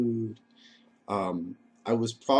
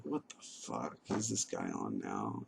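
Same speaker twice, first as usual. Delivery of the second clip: low, much softer incredulous mutter; muttered inner thought; 13 semitones lower, 11 dB quieter than usual. Retold or imitated. Thinking about his rambling brother: low, soft mutter. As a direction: drop it low, half to yourself.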